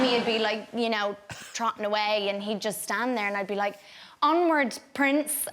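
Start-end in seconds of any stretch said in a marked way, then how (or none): 4.2-5.5 higher, louder regal command; lightly hammed royal order to a horse; queenly and regal. Actress ordering stubborn horse Prince, mock queenly louder command. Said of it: "having to act quite queenly and regal".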